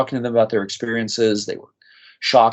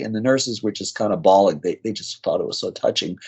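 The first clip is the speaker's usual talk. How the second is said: ordinary talk, nothing marked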